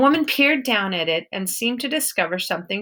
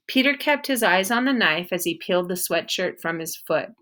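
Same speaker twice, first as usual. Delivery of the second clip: plain reading voice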